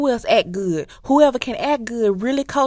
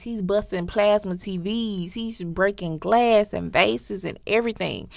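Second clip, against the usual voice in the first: ordinary delivery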